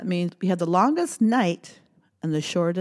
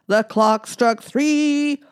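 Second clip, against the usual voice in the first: slow announcing; 5 semitones higher, 8 dB louder, slower than usual. Pretend. Counting song, clock strikes.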